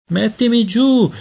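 put-on desperate baby demand. A narrator's reading. Peter kicking in Aunt Laura's arms, demanding down. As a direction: howl to be put down.

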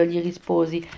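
ordinary delivery

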